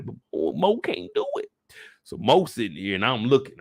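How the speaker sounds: usual speaking voice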